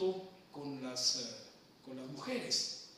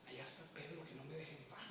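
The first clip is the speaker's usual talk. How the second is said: much softer, a hushed complaint; quiet aside; 3 semitones lower, 12 dB quieter than usual. Retold or imitated. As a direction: mutter it aside to the master. Juan se queja en voz baja. (queja en voz muy baja)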